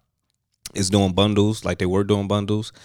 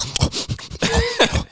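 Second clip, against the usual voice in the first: shrill high-pitched cackle; high laugh. Retold or imitated. Imitating the friend's reply, a high laugh. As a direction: let out a squeal of laughter.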